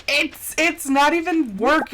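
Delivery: ordinary talk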